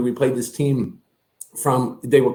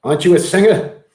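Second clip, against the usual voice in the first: put-on dad's accent, pitched up a little; dad impression; 4 semitones higher, 4 dB louder than usual. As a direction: put the question as blunt Huey, raised voice. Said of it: "Huey looks at him and goes".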